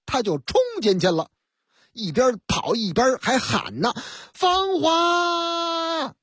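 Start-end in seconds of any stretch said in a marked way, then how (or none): 4.3-6.2 calling out. Acted. Husband calls for wife.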